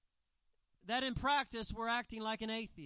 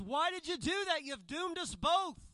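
higher, louder doomed cry; screaming frog; 6 semitones higher, 4 dB louder than usual. Acted. Drowning frog screams at the scorpion that stung it, crying out. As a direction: cry out in loud, high-pitched dismay. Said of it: "screams out and says".

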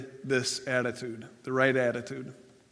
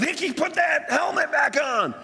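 much higher, strained mock shout; imitated coach's yell; 12 semitones higher, 3 dB louder than usual. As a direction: holler it like a coach across a field. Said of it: "His coach yelled".

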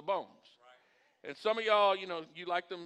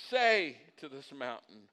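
"say" loud and drawn out, the rest lower; stress on SAY; 3 semitones lower, 4 dB louder, slower than usual. Shown as a demonstration. Pastor driving the verse home, stress on "say". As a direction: stretch and punch the word "say".

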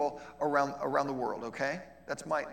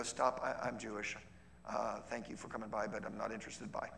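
curt refusal; 6 semitones lower, 9 dB quieter than usual. Retold or imitated. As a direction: lower and softer, a firm brush-off. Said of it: "and they go".

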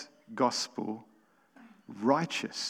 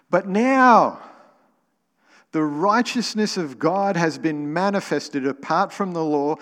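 louder, raised reading of the verses; scripture reading; 2 semitones higher, 4 dB louder than usual. Acted. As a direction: proclaim the passage to the congregation.

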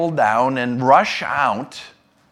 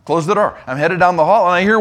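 ordinary talk

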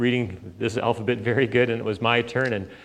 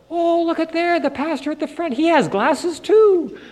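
put-on delighted child. Boy at the back of church spotting the pastor's glasses, amazed. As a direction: point it out like a thrilled little boy.

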